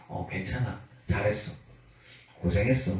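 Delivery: low, soft, tender consoling voice; gentle reassurance. Acted. God telling the weary they did well, tender and low. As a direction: comfort them softly, pausing between phrases.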